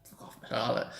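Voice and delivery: whispered fuck off; a fan whispering curses at a rival. Retold or imitated. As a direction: hiss it under your breath. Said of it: "and I was like".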